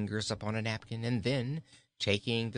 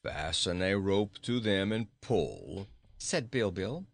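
gruff goat; 3 semitones lower than usual. A narrator's reading.

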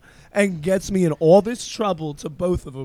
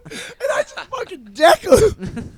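usual speaking voice

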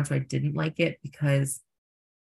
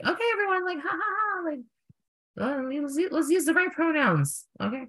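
very high, put-on polite voice with fake laugh; mock-cheery announcement; 13 semitones higher than usual. Reminding everyone about pronouns, mock-cheery and very high. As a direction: squeak out a forced, polite group reminder.

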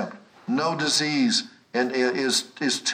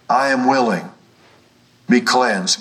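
louder, forceful quoted declaration; emphatic declaration; 7 dB louder than usual. Retold or imitated. Preacher quoting Jesus to assure the sick, emphatic and loud. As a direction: drive each phrase home loudly, with pauses.

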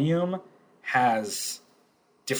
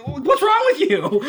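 high, loud, drawn-out mock disbelief; hammed-up yell, slow and high; exasperated outburst; 8 dB louder than usual. Club owner who doesn't get the detective's taunt, exasperated.

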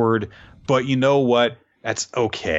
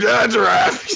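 high, loud theatrical exclamation; mock giraffe cry; 8 semitones higher, 5 dB louder than usual. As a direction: ham it up like a stage actor. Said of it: "We're just like".

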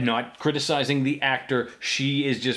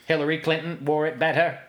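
Martian voice; 3 semitones higher than usual. Shown as a demonstration. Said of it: "Martian accent is".

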